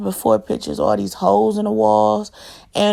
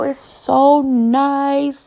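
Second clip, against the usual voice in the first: very drawn-out, higher compliment; imitated gushing visitor; admiring visitor; 4 semitones higher, much slower than usual. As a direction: stretch the praise like an impressed guest. Quoting visitors praising the house.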